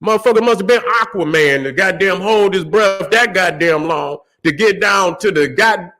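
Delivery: usual speaking voice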